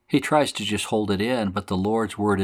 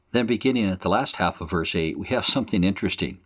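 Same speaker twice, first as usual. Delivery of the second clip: normal talking voice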